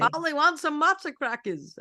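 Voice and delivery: high, loud parrot squawk. Retold or imitated. Imitating a parakeet.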